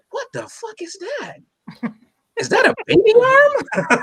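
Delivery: high, disgusted shocked voice. Pretend. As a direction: recoil at the gift, high and horrified. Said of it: "you're gonna go".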